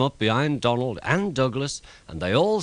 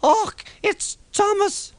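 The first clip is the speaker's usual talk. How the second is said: much higher, slow Scottish-tinged cry of discovery; excited cry; 4 dB louder, slower than usual. A narrator's reading. The twins finding Thomas in the snow, excited high cry. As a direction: cry out in joyful surprise. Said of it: "they cried together".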